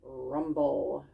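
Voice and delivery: ordinary delivery, nothing marked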